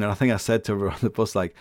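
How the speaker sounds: ordinary delivery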